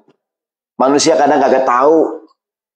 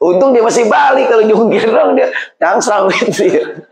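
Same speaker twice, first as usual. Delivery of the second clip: laughing; 7 semitones higher than usual. Real, not acted. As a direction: laugh through the joke. Joking about the housewife's day.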